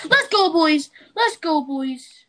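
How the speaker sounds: hyped shout, high and loud; frat bro. Real, not acted. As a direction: yell it like a pumped-up fan. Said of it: "I can hear that".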